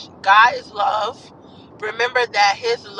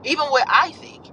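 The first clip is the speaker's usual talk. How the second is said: ordinary talk, nothing marked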